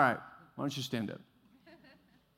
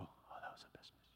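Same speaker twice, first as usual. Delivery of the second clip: hushed whisper of flattery; whispered praise; 25 dB quieter than usual. Retold or imitated. Church member whispering to pastor after sermon, hushed.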